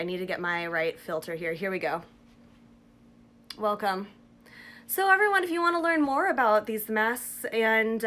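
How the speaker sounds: mask covering lips; speaking through damp fabric; through a sheet mask. Real, not acted. Seen 5: Printed sheet mask covers face and lips throughout; references show bare face.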